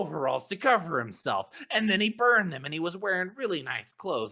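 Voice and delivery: high, loud aggrieved rant. Acted. Smith complaining about his burnt overalls, ranting. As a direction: pour out the grievance, pitched well up. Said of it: "I start ranting".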